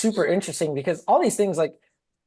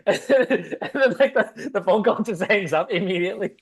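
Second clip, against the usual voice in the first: laughing; 7 semitones higher than usual. Real, not acted. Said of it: "It's hilarious".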